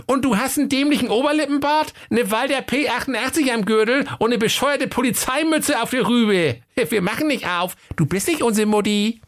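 put-on very high, squeaky kid voice; high-pitched, bratty fairy-tale goatlings; innocent little goats. A narrator's reading. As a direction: play cheeky little kids acting innocent. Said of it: "taten die Geißlein recht unschuldig".

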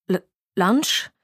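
stuttered, questioning echo; baffled stammer. A narrator's reading. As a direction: barely get the word out. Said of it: "brachte Walter Pine heraus".

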